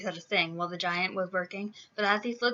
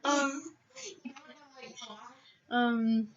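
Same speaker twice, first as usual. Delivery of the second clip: usual speaking voice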